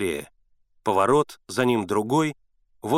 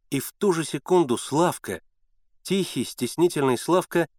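plain reading voice

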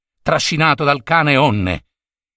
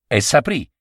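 ordinary delivery, nothing marked

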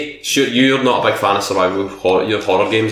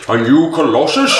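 higher, questioning put-on wizard voice; Gandalf; 5 semitones higher than usual. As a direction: ham it up like a wizard, rising at the end. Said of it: "the Gandalf voice".

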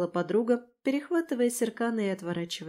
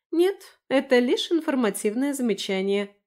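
high, lively woman's retort; raised-pitch, pointed clarification; Lera's reply; 6 semitones higher, 4 dB louder than usual. A narrator's reading. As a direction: answer pitched up, starting emphatically. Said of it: "ответила Лера".

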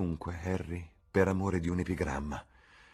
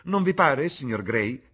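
much higher and louder, urgent; alarmed; 7 semitones higher, 9 dB louder than usual. A narrator's reading. Duchess alarmed as Dorian turns faint, high cry.

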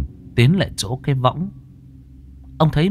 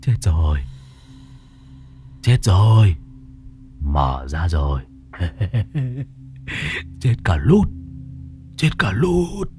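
cackling madman. A narrator's reading. Madman laughing at the caretaker by the graves, crazed cackling. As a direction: chant it madly through cackles. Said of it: "rồi lão bật cười".

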